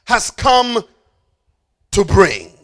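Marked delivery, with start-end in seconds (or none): none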